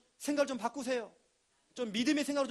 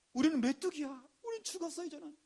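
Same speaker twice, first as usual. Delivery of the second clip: mock panic; 6 semitones higher than usual. Spies wailing their doom.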